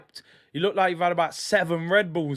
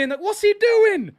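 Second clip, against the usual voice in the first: exasperated shout; 14 semitones higher, 4 dB louder than usual. Real, not acted. Shouting at keeper again.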